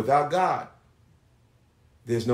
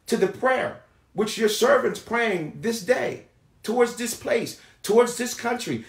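raised-pitch, pleading public prayer; intercessory prayer; 9 semitones higher than usual. Acted. Servant asking God to hear the nations, intercessory prayer. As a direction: pray aloud in insistent phrases, pausing between petitions.

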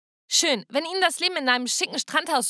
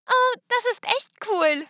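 put-on high, slow, wide-eyed child voice; little girl voice; 10 semitones higher, 3 dB louder, slower than usual. Youngest sister admires the party from the garden, high and slow childlike. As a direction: say it like an awestruck little kid.

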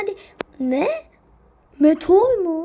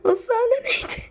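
laughing disbelief; 5 semitones higher, 3 dB louder than usual. Real, not acted. Laughing at absurd play.

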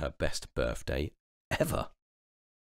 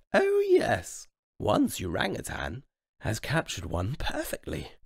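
queen admiring; 8 semitones higher than usual. Acted.

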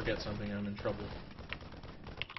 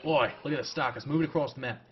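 louder, lively Australian commentary; Australian accent; 3 semitones higher, 4 dB louder than usual. Acted. Gamer narrating the Stalkers' attack, in an Australian accent. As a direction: put on an Australian accent and call the action. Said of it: "saying it in Australian".

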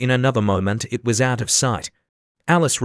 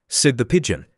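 usual speaking voice

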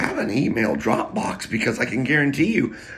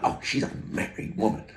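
hushed quote; 5 semitones lower, 8 dB quieter than usual. Retold or imitated. Retold gossip in a car.

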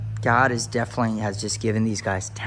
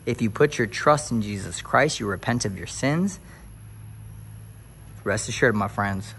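preacher climax; 4 semitones higher, 5 dB louder than usual. Acted. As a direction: lean into the church call-and-response. Preaching to viewers.

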